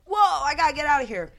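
fast, high, loud mock panic; panicked. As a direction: blurt it out in a hurry. Scared man voiced in story.

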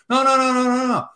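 high rapid-fire string of refusals; insistent retold refusal. Reporter brushing off his editor's protest, insistent and high.

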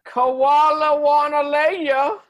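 high, loud, slow Australian punchline; hammed-up terrible Australian accent; bad Australian accent. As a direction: belt out a drawn-out mock-Australian line. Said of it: "the worst Australian accent".

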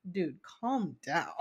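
low, hushed, slow appeal; imitated calming husband. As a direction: bring it right down, slow and soft. Husband calming her, retold.

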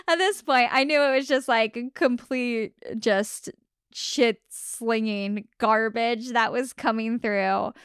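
ordinary delivery

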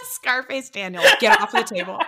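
loud playful scold at a pet; mock scolding. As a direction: pretend stern owner. Scolding the cat in jest.

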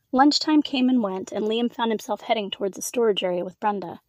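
plain reading voice

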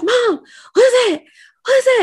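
high, loud, panicked cry to mom; scared child calling out; freaked-out yell. Retold or imitated. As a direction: yell in sudden fright at something over mom's head. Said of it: "And I freaked out".

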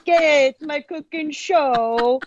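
high, drawn-out show intro; mock-announce a homemade cooking show, slow and high; cooking show host. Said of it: "but they'd be like".

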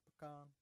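"Pecan" said puh-KAHN; "Pecan" as puh-CON; pronunciation of pecan. Shown as a demonstration. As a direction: say "Pecan" with an open ah at the end. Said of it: "The pronunciation of pecan".

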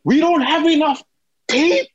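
high, strained whine; panicked high plea. Retold or imitated. Student trainer telling boss Eric about the tape, panicked high plea. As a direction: plead like you're about to be fired.

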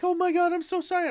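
high, flustered apology; apologetic woman. Retold or imitated. Dog walker apologizing to the owner, high and flustered. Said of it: "girl walking this dog is like".

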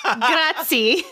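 hammed-up Italian grazie, laughing; proud mock Italian thanks for the praise; Italian accent. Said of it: "flawless Italian accents".

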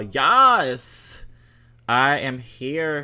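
loud, high cheer of yes; excited yes. Real, not acted. Host cheering Capricorns in a listener review, loud excited cheer. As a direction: cheer it like a hype shout.

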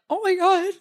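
high, awed oh my God; stunned by the dress. Retold or imitated. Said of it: "And I was like".

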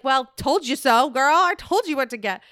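high, loud sassy I-told-you-so; mock gloating. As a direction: crow it like a sassy friend. Acting a gloating reply to guest.

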